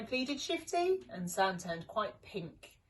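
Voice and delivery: usual speaking voice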